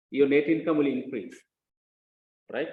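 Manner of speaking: ordinary talk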